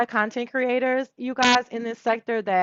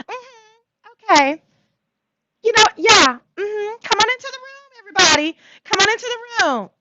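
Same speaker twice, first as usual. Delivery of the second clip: much higher, loud playful hostess voice; high sassy welcome; 12 semitones higher, 5 dB louder than usual. Acted. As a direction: welcome them in with camp flair.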